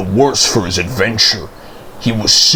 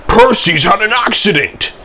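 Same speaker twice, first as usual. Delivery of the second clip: hurried news; 10 semitones higher than usual. A narrator's reading. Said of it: "Toby hurried in saying".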